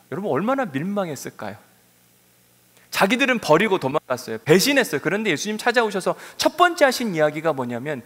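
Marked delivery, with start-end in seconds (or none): none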